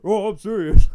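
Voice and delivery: loud, high mocking voice; mocking imitation. Friend mocking the tall kid's threat, loud and high. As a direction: parrot him back to make fun of him. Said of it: "he starts mocking him".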